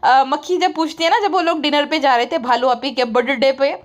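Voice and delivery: normal talking voice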